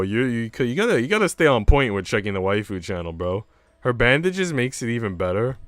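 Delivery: normal talking voice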